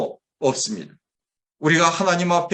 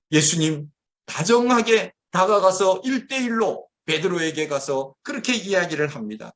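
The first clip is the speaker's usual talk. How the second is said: ordinary talk, nothing marked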